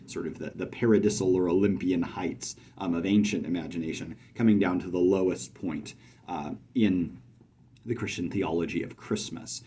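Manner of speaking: ordinary delivery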